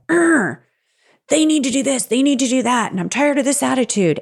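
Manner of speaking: mock groaning rant about the kids; hammed-up annoyed griping; frustrated rant. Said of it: "you're just yelling at me".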